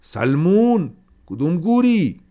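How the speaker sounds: yelled high and loud, furious; angry shout. A narrator's reading. Haji yelling for Salman in the courtyard, furious shout. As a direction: bellow across the yard for the servant. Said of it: "داد زد".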